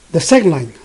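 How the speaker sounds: ordinary talk, nothing marked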